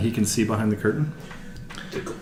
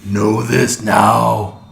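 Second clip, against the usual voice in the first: very slow, loud alien command; booming alien voice; 6 dB louder, much slower than usual. Acted. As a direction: thunder the words down at him. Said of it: "that loud alien voice you heard before booms out".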